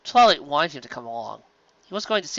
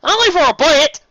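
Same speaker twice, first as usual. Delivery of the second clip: high, loud defensive protest; loud high defensive yelp; 10 dB louder than usual. Acted. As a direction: protest it fast in a put-on high voice. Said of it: "Applejack defended herself".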